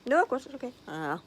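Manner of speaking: talking while chewing cake; words round a bite of sponge; mouth full. Real, not acted. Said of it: "I'll try not to talk with my mouth full".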